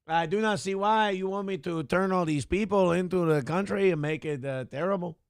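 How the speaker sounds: a light French Canadian accent, mock serious; the foreign leader answering Trump in a French Canadian accent. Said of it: "Now he's a little French Canadian in there".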